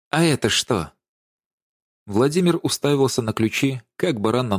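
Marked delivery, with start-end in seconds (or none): 0.1-0.9 tight, accusing question; through gritted teeth. A narrator's reading. Даша confronts Владимир with the keys, through gritted teeth. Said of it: "и процедила".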